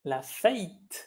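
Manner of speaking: "La fête" said with a Quebec diphthong, like "la feite"; la fête à la québécoise, voyelle diphtonguée; Quebec French fête. Shown as a demonstration. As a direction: demo the Canadian "fête" with the vowel gliding to "ei". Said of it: "en Canadá ellos dicen la fête".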